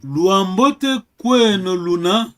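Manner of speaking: loud, slow question with rising end; slow practice question. Shown as a demonstration. Teacher asks student on behalf of the crowd, slow practice question. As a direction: put the question slowly and loudly.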